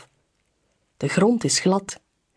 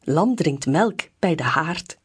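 plain reading voice

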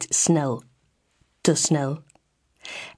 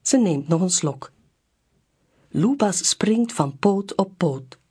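ordinary delivery, nothing marked

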